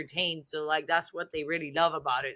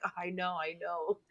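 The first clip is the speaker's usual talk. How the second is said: sheepish admission; 12 dB quieter than usual. Retold or imitated. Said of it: "I'm like".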